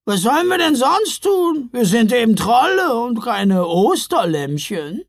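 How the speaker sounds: high, loud troll protest; nervous trolls. A narrator's reading. Trolls defending their nature to the Santas, nervous and loud. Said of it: "Nervös sahen sich die Trolle an".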